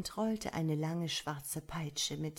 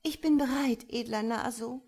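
a high, louder, strained yes-sir; pressed out obediently; 7 semitones higher, 6 dB louder than usual. A narrator's reading. Bound girl answers her tormentor, pressed out obediently. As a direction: squeeze it out through the pain, obedient. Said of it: "presste Sahara gehorsam hervor".

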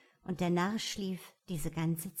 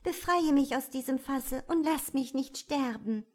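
higher, pleading princess voice; 6 semitones higher, 4 dB louder than usual. A narrator's reading. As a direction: beg for her life, high and urgent. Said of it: "Die Prinzessin fing wieder an zu bitten".

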